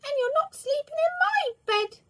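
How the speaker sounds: very high, loud cub squeak; the little bear refusing his bed to Mister Lazy; squeaky little bear. A narrator's reading. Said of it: "squeaked the little bear".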